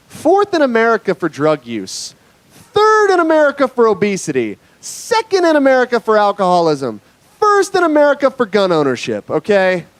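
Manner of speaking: high, proud announcer cry building with each rank; rallying countdown. Acted. Comedian rallies crowd with state stats, hype announcer.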